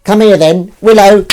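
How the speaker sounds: high, loud repeated pet call; coaxing the cat over. Real, not acted. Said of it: "I'm trying to get her to come here".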